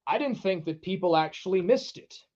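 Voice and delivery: ordinary talk, nothing marked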